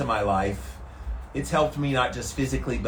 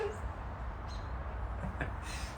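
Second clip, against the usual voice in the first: laugh; 14 dB quieter than usual. Real, not acted. Laughs after singing.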